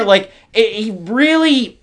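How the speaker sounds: ordinary delivery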